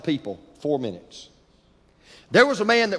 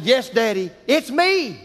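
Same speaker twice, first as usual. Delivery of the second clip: put-on high, eager child's voice; little boy answering; 7 semitones higher, 4 dB louder, slower than usual. Trapped boy calling up to his father, high and eager. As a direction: let the little boy's relief burst out high. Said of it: "And Armand said".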